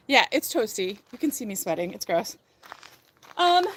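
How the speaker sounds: ordinary talk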